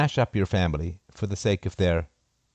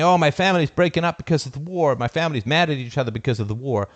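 higher, louder whine that settles; mock complaining; 6 semitones higher, 3 dB louder than usual. Imitating people blaming family rifts on war, mild mock whine. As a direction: parody someone blaming the war.

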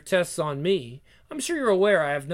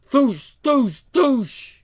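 blaster sound effect; 6 semitones higher, 4 dB louder, much slower than usual. Acted.

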